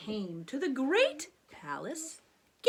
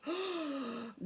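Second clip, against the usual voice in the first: shocked gasp; 7 dB quieter than usual. Acted.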